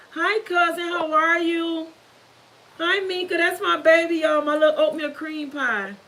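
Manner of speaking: high, sing-song hello to family; affectionate greeting. Real, not acted. Streamer greets cousin and baby joining the live, affectionate and high-pitched. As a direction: greet loved ones in a high, doting voice.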